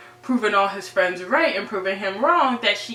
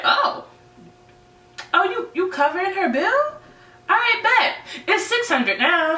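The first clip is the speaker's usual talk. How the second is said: much higher, mocking upcharge; jerk connect; 12 semitones higher than usual. The connect taunting Fez over Rue's bill, high and mocking. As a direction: needle Fez with a sneering price hike. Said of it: "being the jerk that he is".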